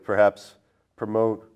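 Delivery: ordinary talk, nothing marked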